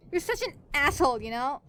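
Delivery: loud, high blurt; outburst. A narrator's reading. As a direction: let it burst out. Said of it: "she burst out".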